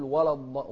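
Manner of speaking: ولا loud with a dark, thick l; heavy lam mistake. Retold or imitated. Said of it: "ما نقول". (تفخيم اللام في ولا)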